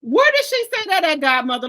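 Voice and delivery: loud, high, demanding where; incredulous question. Real, not acted. Streamer asking a viewer for the source, loud incredulous. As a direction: press for proof, stunned.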